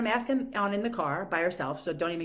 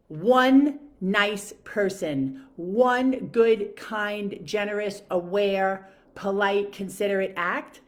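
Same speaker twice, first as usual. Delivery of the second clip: each word punched louder, very slow at the start; preaching kindness to viewers, louder; emphatic list; 7 dB louder, much slower than usual. Real, not acted. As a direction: hammer home every virtue. Listing virtues emphatically.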